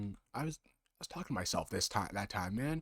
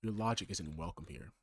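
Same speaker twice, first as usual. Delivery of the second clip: normal talking voice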